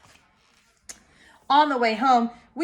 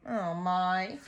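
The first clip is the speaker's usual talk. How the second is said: usual speaking voice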